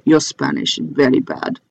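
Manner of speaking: lightly voiced blunt kid, half laughing; deadpan jab through a laugh; imitating the kid. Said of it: "he turned around to me and said".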